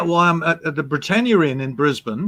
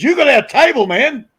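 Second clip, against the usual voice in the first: high, loud retold challenge; confronting; 11 semitones higher, 6 dB louder than usual. To Ray over the stolen table, high loud challenge. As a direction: call him out on it.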